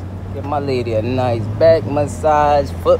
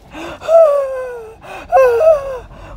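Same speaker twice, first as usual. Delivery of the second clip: loud, very high drawn-out gasps; hammed-up high-pitched wail; mock wailing gasp; 8 dB louder than usual. Driver appalled at the street, mock wailing gasp.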